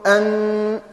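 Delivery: "أن" with a long humming n; ghunna on nun. Shown as a demonstration. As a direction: hold the n of "أن" through the nose. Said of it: "يكون في النون".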